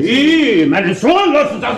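father flaring up over his son; angry outburst. Acted. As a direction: snap back to protect your son.

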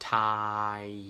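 "time" stretched slowly from AH to EE; drawn-out vowel glide. Shown as a demonstration. Tutor builds the word sound by sound, drawn-out vowel glide. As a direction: hold the glide in "time", barely closing it. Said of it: "เป็นสระอิ แล้วก็เพิ่มมาม้าเข้าไป".

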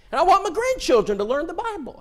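a hint of a proud grandma; woman's voice. Preacher voices the visitor.

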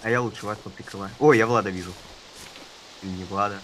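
normal talking voice

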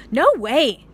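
loud, high no way; farmer refusing. Acted. Farmer Brown refuses the cows, loud and sharp. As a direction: shut them down firmly. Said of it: "said Farmer Brown".

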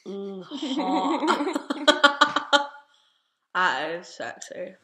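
lips pulled back by fingers; laughing through dental tray; speaking with mouth tray in. Real, not acted. Seen 0: Clear tray on teeth, fingers stretching lips while she laughs.

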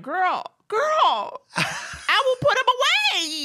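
high, drawn-out comic comeback; hammed-up high-pitched giving in, stretched at the end; mock protest. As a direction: sing out the reply way up high. Mock reply to partner's serious complaint.

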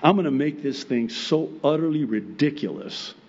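ordinary talk

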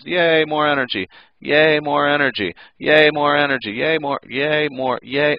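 high, sing-out yays on repeat; mock cheering. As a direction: keep cheering like a runaway reaction. Chain reaction cheering over and over.

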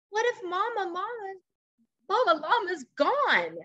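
high, worried little llama voice; fearful baby llama. Acted. Teacher reading to class as worried baby llama, high and fearful.

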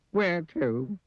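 high, doleful little question; sad squeaky voice. Acted. Mister Miserable asks where they are going, sad and squeaky. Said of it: "Sad, squeaky sort of voice".

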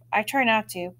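usual speaking voice

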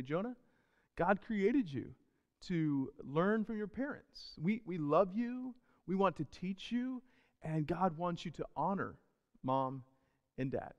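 soft, slow, patient dad voice; calm a toddler down gently and slowly. Retold or imitated. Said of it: "very calmly just say".

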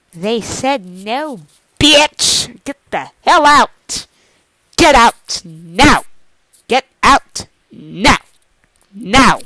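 slow, loud commanding shout; a loud, slow, furious eviction; loud order. Acted. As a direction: throw them out, spacing each word. Throwing the intruder out.

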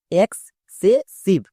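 Excessive broken into syllables, very slow. Shown as a demonstration. Teacher splitting excessive very slowly for students. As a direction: draw out each part of excessive. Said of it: "এবার একসঙ্গে উচ্চারণ করো".

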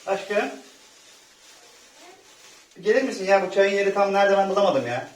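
loud call to the next room. Acted. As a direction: call out from the kitchen. Calling partner from the kitchen.

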